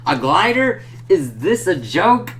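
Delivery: high, loud, slow indignant scoff; pissed villain. Retold or imitated. Reviewer voicing angry Hobgoblin mocking the glider, loud indignant scoff. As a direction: spit it out in outraged disbelief. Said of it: "the Hobgoblin's pissed".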